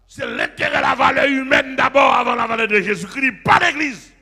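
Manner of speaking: loud, fast, fervent sermon; full-force preaching shout; fiery preaching. Real, not acted. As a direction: hammer the point home to the church.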